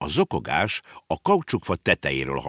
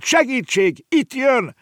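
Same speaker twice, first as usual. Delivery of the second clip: very high cry for help; panicked cry; 4 dB louder, slower than usual. Acted. Girl as the whale arrives, panicked cry. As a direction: call out in childish alarm.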